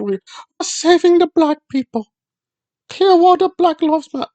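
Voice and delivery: high-pitched mocking crusader voice; mock activist. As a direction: put on a high, overwrought do-gooder voice. Mocking a cause nobody believes.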